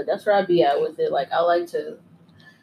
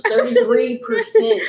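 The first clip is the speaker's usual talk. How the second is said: laughing; 6 dB louder, slower than usual. Real, not acted. Laughing disbelief at strong weed.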